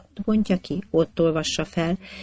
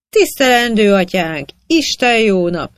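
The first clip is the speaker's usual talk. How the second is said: high, loud hail from a reveller; kiáltás; 6 semitones higher, 7 dB louder than usual. A narrator's reading. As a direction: call out to stop a passing cart. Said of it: "kiáltotta a kupak tanács egyik érdemes". (magas hangon, hangosan odakiáltva)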